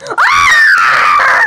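sudden high shriek; startled shriek. Real, not acted. Kid shrieks at the game's sudden attack. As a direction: shriek as the hit lands. Kid gets hit in game.

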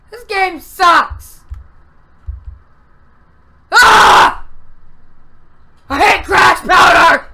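loud raging yell; real frustrated outburst; furious shouting. Real, not acted. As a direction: scream your anger at the game. Raging after death in game.

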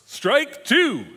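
high, loud umpire shout; punchy shouted game call; umpire's call. Acted. Umpire calling the strike.

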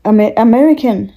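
put-on American accent. Shown as a demonstration. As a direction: say "américain" like an American, "American". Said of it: "parler avec l'accent".

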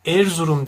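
Erzurum with an overly closed e. Shown as a demonstration. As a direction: close the e of Erzurum too much. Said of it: "dersem kapalı telaffuz etmiş olurum". (aşırı kapalı e ile Erzurum)

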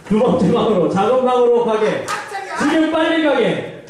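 high, archaic commanding tone; old-fashioned MC. Acted. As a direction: play a theatrical game host. MC orders in an archaic style. (사극풍 진행자 말투)

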